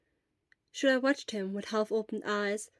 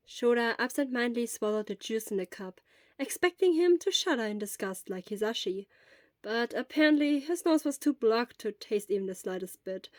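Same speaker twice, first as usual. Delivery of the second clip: plain reading voice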